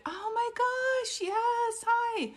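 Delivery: high, slow delighted greeting; excited squeal. Real, not acted. Greeting a returning viewer.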